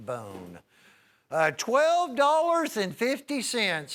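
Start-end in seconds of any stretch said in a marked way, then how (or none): 1.3-3.9 price called out high and loud, twelve punched; much higher, louder pitchman price call. Real, not acted. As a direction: announce twelve like a bargain reveal. Announces the price.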